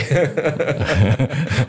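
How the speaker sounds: real amused laugh; a short burst of laughter. Real, not acted. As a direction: laugh openly at the excuse. Laughs at the excuse again.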